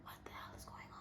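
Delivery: retold shock in a near whisper; barely voiced, stunned question; hushed disbelief. Retelling her shocked phone reaction.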